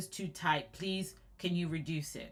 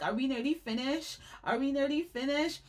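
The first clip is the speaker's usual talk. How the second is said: put-on higher, pleading child voice; whiny kid; 5 semitones higher than usual. Teen in the salon chair, imitating a whiny child. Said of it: "I would be crying. I'm like".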